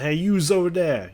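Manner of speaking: hammed-up New York "yous over there"; New York accent. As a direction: call across the street like a New Yorker. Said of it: "a New York accent where people say".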